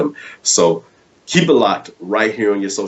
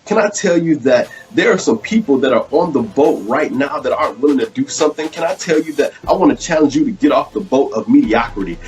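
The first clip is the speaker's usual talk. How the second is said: fiery preaching; 8 semitones higher than usual. Acted. Preacher urging listeners to get off the boat, fiery full-force preaching. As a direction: preach it at full force, rising with each line.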